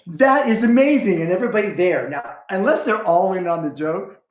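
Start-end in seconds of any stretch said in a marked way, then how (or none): 0.1-1.2 high, louder mock amazed gasp; mock amazement. Tutor amazed by a simple cast, high mock awe. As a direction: act floored by something easy.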